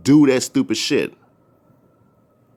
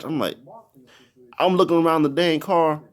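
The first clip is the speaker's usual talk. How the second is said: normal talking voice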